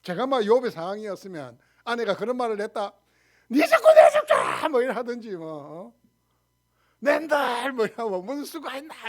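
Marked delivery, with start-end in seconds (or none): 3.5-4.7 very high, loud drawn-out outburst; acted furious yell. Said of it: "이러고 막 고함을 칠 건데". 7.0-7.7 high, loud drawn-out exclamation; acted husband yelling. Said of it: "이러고 막 고함을 칠 건데". 8.2-9.1 high-pitched mock exasperation; acted husband yelling. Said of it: "이러고 막 고함을 칠 건데".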